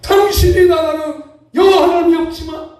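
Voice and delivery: high, loud declaration with stretched words; proud royal proclamation. Acted. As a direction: proclaim it boldly like a welcoming king. Acts out a confident king's reply. (왕처럼 높고 크게 당당히 외치기)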